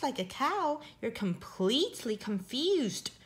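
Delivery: ordinary delivery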